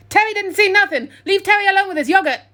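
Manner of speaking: loud, high defensive yell. A narrator's reading. Police officer yelling at the trio, loud high yell. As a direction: bellow it like a cornered man. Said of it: "looked up and yelled".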